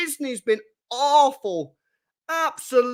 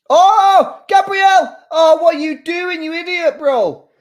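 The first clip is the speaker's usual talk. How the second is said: high, loud shout at the player; outraged shout; 6 semitones higher, 9 dB louder than usual. Real, not acted. Fan yelling at his own defender's blunder, outraged shout. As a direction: yell at him in disbelief.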